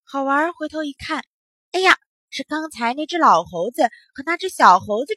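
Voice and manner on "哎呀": ordinary delivery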